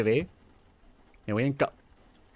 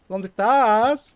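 drawn-out call; 7 semitones higher, 4 dB louder, much slower than usual. Pretend. Searching for walking doll.

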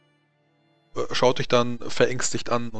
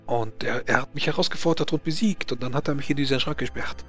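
put-on stammering Frenchman, heavy French accent; nervous French nobleman voice; 2 semitones higher than usual.

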